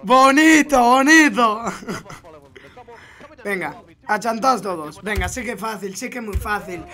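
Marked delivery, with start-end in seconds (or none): 0.0-1.6 loud, slightly high "bonito"; loud praise. Real, not acted. Streamer praising a clip, loud and pleased. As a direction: cheer the play out loud.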